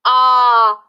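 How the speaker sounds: a loud held ah; loud held ah for the vowel; vowel sound ah. Shown as a demonstration. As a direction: sing out the vowel ah. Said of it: "What vowel says ah?".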